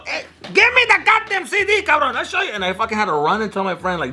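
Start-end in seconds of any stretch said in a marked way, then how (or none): 0.1-2.5 high, demanding dad outburst; imitated yelling dad. Dad ordering son to hand over the CD, imitated yelling. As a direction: act out a dad demanding the disc back. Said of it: "from yelling at me over and over".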